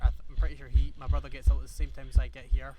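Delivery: loud, panting while running; out of breath. Real, not acted. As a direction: push words out between breaths. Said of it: "so I am out of breath".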